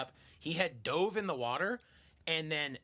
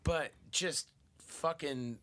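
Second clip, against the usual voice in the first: usual speaking voice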